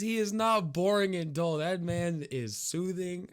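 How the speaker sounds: loud, high playful scolding; drawn-out, emphatic telling-off; mock indignation. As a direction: leap to a hero's defence in mock outrage. Mock scolding in defence of Bob Ross.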